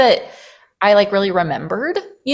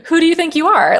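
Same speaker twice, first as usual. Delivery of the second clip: scoffing, rising to a high, louder jab at the end; mocking inner critic; 5 semitones higher, 4 dB louder than usual. Her inner critic mocking her for easy work, scoffing. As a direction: sneer the last question up high. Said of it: "so it was almost like".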